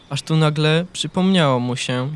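plain reading voice

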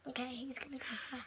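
hushed, quick whisper. Real, not acted. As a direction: whisper it nervously. Nervous whisper before the challenge.

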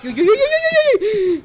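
high, slow whimpering cries; frightened wail. Real, not acted. Player wailing as the creature appears, frightened. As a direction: cry out in fear.

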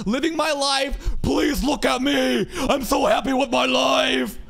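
high, gushing fake-happy bragging, building to a shout; mock social-media flex. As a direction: squeal it like a desperate show-off. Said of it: "Then we can flex like".

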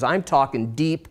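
normal talking voice

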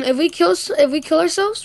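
ordinary talk

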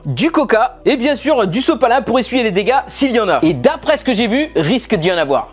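normal talking voice

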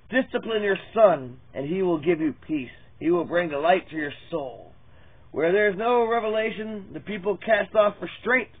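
scripture reading. Acted. Reading Proverbs to his viewers as a preached scripture. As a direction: proclaim each proverb like a preacher. Reads Proverbs aloud as sermon.

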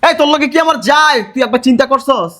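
high-pitched, scornful challenge; scornful anger. Acted. Boyfriend telling her she is no match, scornfully.

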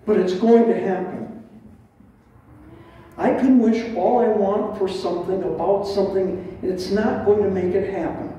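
normal talking voice

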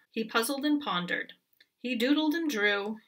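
usual speaking voice

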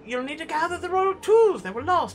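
a hint of a grand old wizard, read aloud; lightly voiced wizard. Gamer reading the wizard's lines in a light wizard voice. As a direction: play the wizard with gentle pomp.

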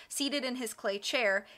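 ordinary delivery, nothing marked

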